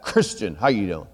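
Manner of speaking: low, soft ex-Marine greeting; imitating George. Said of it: "like that".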